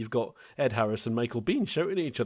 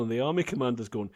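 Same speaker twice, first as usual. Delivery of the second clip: normal talking voice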